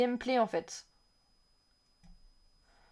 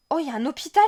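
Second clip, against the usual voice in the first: excited discovery; 4 semitones higher, 7 dB louder, much faster than usual. Real, not acted. Discovering a hospital in game.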